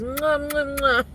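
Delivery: exaggerated "mwah" kisses; playful kiss noises. Streamer sending Christmas hugs and kisses, playful smooches.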